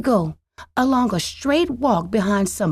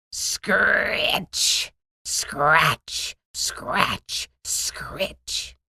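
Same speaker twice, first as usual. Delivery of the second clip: scratchy sound fading from low to a whisper; hoe scratching; 3 semitones lower, much slower than usual. A narrator's reading. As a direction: mimic the hoe, dying away to nothing. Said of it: "he heard the noise of a hoe".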